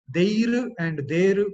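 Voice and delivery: "there" very slow, as DAY-ru, R rolled; slow, heavy-R "there and there"; Telugu and Hindi-based pronunciation. Shown as a demonstration. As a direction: drag out "there" with a hard D and sounded R. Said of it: "Telugu and the Hindi-based pronunciation".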